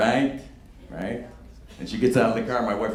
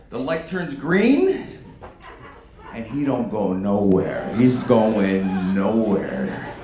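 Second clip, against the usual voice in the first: slowed storytelling; 8 semitones lower, 3 dB quieter, much slower than usual. A narrator's reading. Slow telling of stalled car.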